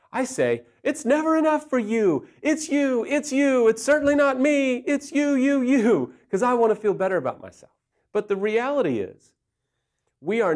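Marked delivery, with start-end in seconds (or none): 0.8-6.1 high, raised accusing outburst; mock blaming rant. Husband blaming wife to feel better about himself, mock accusing. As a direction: fire the blame back, pitched way up.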